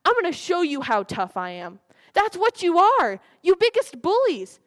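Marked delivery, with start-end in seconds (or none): 2.1-4.6 much louder and higher, hammed-up taunting; mock bully shout. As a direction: bellow it like a playground bully, in jest. Mock-bully outburst at the audience.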